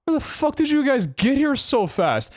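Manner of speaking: high, startled disbelief; a high, incredulous retold outburst; shocked outburst. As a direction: blurt it out, stunned at how fast they came. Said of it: "I'm like".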